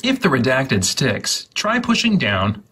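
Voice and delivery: usual speaking voice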